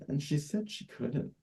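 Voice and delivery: ordinary delivery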